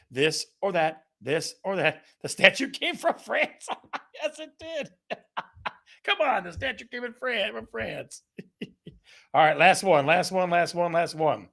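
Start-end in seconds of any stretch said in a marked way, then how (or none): 2.2-8.2 high, laughing through the words. Real, not acted. Host laughing at the France joke. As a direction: giggle it out.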